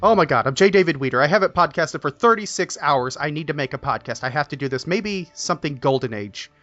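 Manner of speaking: ordinary talk, nothing marked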